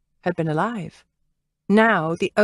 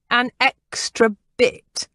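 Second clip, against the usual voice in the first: disdainful; 5 semitones higher, 3 dB louder, slower than usual. A narrator's reading. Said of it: "disdain dripping from her words".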